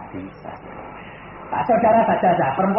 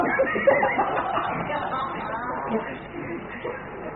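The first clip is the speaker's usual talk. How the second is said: high chuckle; real laugh; 7 semitones higher, 3 dB quieter than usual. Real, not acted. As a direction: laugh at your own joke. Laughs at joke again. (tertawa kecil)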